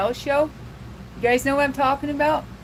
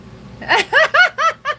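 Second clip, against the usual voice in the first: a big high-pitched burst of laughter; loud high laugh; 6 dB louder than usual. Real, not acted. Reacting to chat, loud high laugh.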